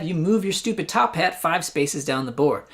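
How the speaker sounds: normal talking voice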